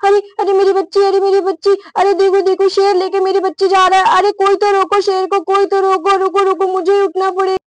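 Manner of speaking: panicked mother. Acted. Mother sees lion carrying off her child, panicked. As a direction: plead for help in rising alarm.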